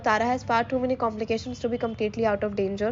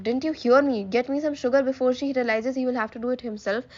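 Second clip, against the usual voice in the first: ordinary delivery, nothing marked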